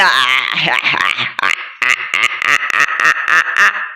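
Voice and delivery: long low chuckle; low laugh. Real, not acted. Laughs during match. (ضحكة منخفضة طويلة)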